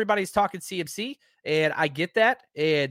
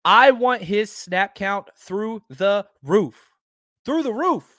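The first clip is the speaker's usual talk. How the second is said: slow and louder, leaning hard on every word; emphatic demand; 5 semitones higher, 5 dB louder, slower than usual. Real, not acted. Host demanding snaps for Jackson, slow and emphatic. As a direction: pound out the demand, then jump higher and repeat it.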